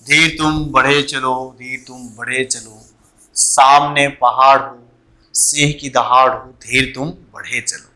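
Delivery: slow, low, measured verse; poem recitation. Acted. Teacher reciting a marching poem to students, measured recitation. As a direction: recite it slowly, softening on the refrain.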